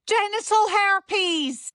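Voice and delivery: slow, drawn-out punchline; punchline reveal. Real, not acted. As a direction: spell out the shock slowly.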